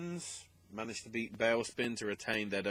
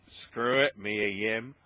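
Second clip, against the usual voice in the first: louder, punchier repeat of the aside; plain; 7 dB louder, slower than usual. Real, not acted. Podcaster shrugging off a name change, loud and emphatic.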